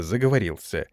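usual speaking voice

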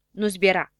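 ordinary delivery

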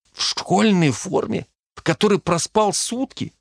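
high, slow, incredulous questions; appalled disbelief. Acted. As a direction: question a friend's outfit in high-pitched disbelief. Приятель возмущён нарядом героя. (возмущённо и недоверчиво, высоким голосом)

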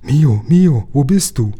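high, loud calling out in fear; boy shouting for his friend he cannot see; frightened shout. A narrator's reading. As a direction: cry out for a lost friend in the dark. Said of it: "Da hörte ich Yum Yum schreien".

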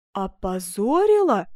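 slow, drawn-out, swelling outcry; offended, incredulous cry; indignant exclamation. Acted. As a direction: throw the word back, stretched and loud. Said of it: "воскликнула герцогиня".

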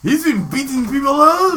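loud, high, badly faked Scottish accent; mock Scottish. Mocking a domestic violence rumour, loud high fake Scottish. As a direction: yelp it in a hammed-up Scottish brogue. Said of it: "I don't know why I'm being Scottish".

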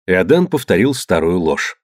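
plain reading voice

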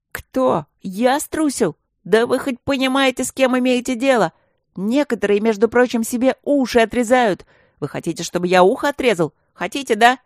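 mock-heroic indignant rant; getting worked up. A narrator's reading. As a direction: build the offended outburst line by line. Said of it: "Не на шутку распалился он".

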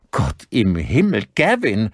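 aghast, rising to a high cry; shocked outcry; appalled exclamation. A narrator's reading. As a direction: exclaim in horror, slowly then high. Rosenbaum appalled at the shooting.

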